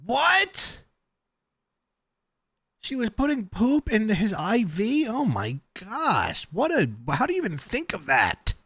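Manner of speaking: very high-pitched, appalled squeal; shocked disbelief. Real, not acted. Shocked reaction to the played report.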